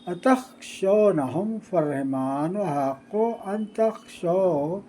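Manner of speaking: slow melodic chanting of the verses; Quran recitation. Shown as a demonstration. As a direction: intone each phrase with a drawn, sung cadence. Teacher resumes Quranic recitation.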